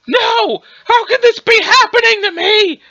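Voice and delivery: high, wailing disbelief; dismayed outcry. Acted. Overpowered fighter protesting defeat, high dismayed wail. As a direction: cry out as defeat sinks in.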